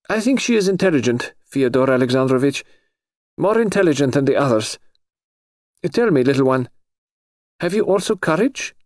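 lightly voiced high noble lady; a touch of a high, haughty aristocrat; lady's voice. Princess voiced in story.

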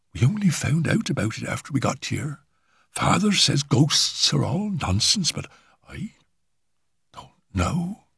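lightly voiced scared girl confiding; a timid girl unsettled by the house; frightened young girl. A narrator's reading. Girl character voice.

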